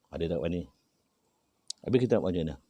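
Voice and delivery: ordinary talk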